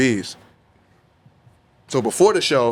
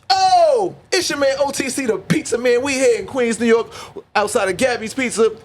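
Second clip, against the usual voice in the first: much higher, hyped-up host voice; hype pizza-man intro; 13 semitones higher than usual. Shown as a demonstration. Rapper opening his pizza review video in a high hype voice. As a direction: open the video like a street-food showman. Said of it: "I'm starting my pizza reviews off".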